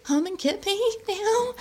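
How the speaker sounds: high-pitched mock wail; hammed-up crying phone call; imitated crying. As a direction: squeal it like a tearful plea. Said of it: "and I'm crying".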